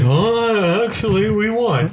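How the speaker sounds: high-pitched, acted hesitant admission; awkward reveal. Acting out awkward reveal of winning.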